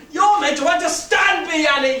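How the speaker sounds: loud, desperate outburst at his wife; husband yelling at his wife to understand him; angry shout. Acted. Husband pleading in a quarrel.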